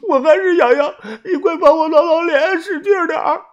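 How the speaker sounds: high tearful whimpering voice; crying. A narrator's reading. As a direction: beg through tears to be scratched. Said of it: "豆芽仔哭着说".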